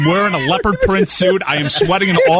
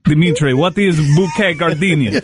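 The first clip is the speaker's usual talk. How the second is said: fake Russian accent; 2 semitones lower than usual. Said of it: "[name]'s fake Russian accent".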